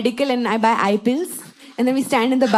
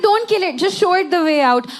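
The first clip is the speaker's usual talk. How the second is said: higher, earnest little plea; pleading friend; 5 semitones higher, 3 dB louder than usual. Retold or imitated. Friend begging her to spare cockroach, higher plea.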